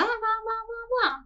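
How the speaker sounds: squeaky high blah blah; high mocking blah. Host mocking Melanie's chatter, high squeaky blahs. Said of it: "I'm just gonna blah".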